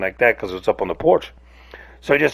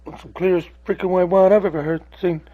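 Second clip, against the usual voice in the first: higher, lightly put-on onlooker voice; mock the audience's guess in a higher pitch; mild mock amazement, pitched up; imagined viewers; 8 semitones higher than usual. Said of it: "And y'all think".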